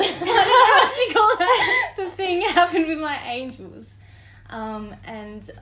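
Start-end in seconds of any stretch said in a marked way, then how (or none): 0.0-4.0 higher, louder, laughing through it; to her cohost about angels, real laughing. Real, not acted.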